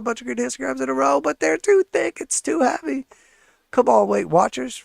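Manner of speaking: high-pitched coaxing, urging the stock on; high pleading cheer. Real, not acted.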